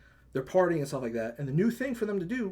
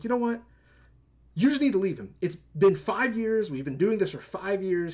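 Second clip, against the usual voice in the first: retold therapist snapping, higher and louder; fed-up outburst; 7 semitones higher, 7 dB louder than usual. Therapist fed up with his client, loud mock outburst. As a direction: let the patience run out all at once. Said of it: "finally he gets fed up".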